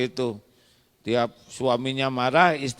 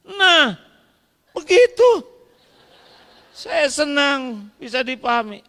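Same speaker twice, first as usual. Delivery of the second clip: much higher and louder, triumphant; pleased husband; 12 semitones higher, 5 dB louder than usual. Acted. Husband pleased with wife, triumphant. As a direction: crow with satisfaction.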